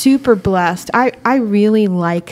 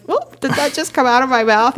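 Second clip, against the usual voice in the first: startled oops; 4 semitones higher than usual. Retold or imitated. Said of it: "and I was like".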